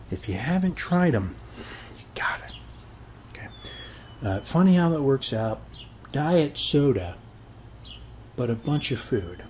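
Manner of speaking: normal talking voice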